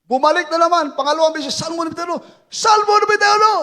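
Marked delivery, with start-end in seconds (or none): 2.5-3.5 fast, high, loud call; shout. Retold or imitated. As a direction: yell it a second time. Shouting the psalm again.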